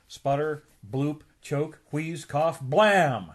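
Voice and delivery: spoken engine sputters building to a loud blam; car sputtering noises. Acted. Narrator mimicking the old car breaking down, sputtering. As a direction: act out a dying jalopy.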